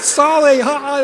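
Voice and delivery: high Chinese-accented word with mock laugh. Chinese brother joking with a Canadian, laughing Chinese accent. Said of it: "he laughed".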